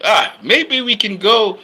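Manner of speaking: mock Alfred, half shouting the suggestion; a mild mock of a snide butler.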